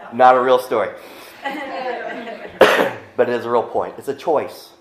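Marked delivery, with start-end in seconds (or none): 0.9-3.1 laughing cough. Real, not acted.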